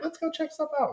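high squeaky mock eagerness; hammed-up high eager voice. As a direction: chirp it like excited tourists. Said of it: "they're like".

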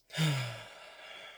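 long, falling out-breath; demonstrated sigh. Shown as a demonstration. Meditation guide taking time to breathe with listeners, long sigh. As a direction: sigh out and let it trail away. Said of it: "You can even add a sigh".

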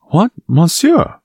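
high, drawn-out indignant question; crying out. A narrator's reading. Young Gascon rebuked by Aramis, crying out in high protest. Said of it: "cried D'Artagnan".

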